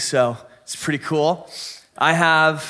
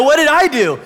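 loud, high-pitched mock protest; indignant protest; 9 semitones higher, 6 dB louder than usual. Son to his mom after she sides against him, high indignant protest.

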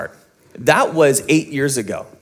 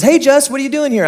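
higher, quicker mock mom, bright with surprise; mother finding her son home unexpectedly, high surprised voice; mom's surprised greeting; 9 semitones higher, 4 dB louder, faster than usual. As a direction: play the startled mother catching her son.

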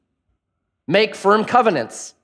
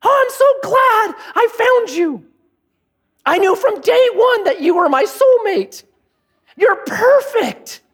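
much higher, slow, gushing lovestruck voice; mock sappy vows; 13 semitones higher than usual. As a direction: ham up a swooning bride reading her own vows. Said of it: "They kinda go like this".

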